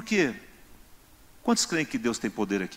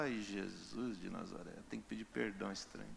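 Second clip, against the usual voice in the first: reluctant grumble; 4 semitones lower, 15 dB quieter than usual. Acted. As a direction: drag it out like you can't believe you must apologize. Believer grumbling at God's command.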